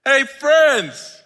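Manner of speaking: high, loud hail; calling out. Acted. Jesus greeting those who failed him, calling out. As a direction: holler a greeting across the water. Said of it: "he called out to them".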